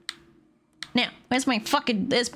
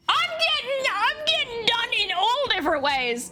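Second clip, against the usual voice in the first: much higher ranting outburst; furious rant; 13 semitones higher than usual. Real, not acted. Gamer raging over a decoration reward, shrieking. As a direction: rage at the screen, pitch climbing.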